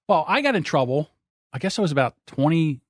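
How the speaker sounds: ordinary delivery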